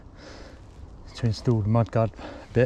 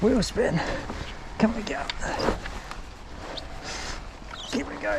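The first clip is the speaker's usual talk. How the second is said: straining effort; 9 semitones higher, 7 dB quieter than usual. Real, not acted. Straining up slippery climb.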